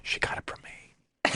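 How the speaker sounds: a sly, hushed brag whispered as a joke; joking whisper. Retold or imitated. Husband whispering a joke about his genius daughter. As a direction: whisper it like a secret boast. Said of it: "I tell my wife, it's like".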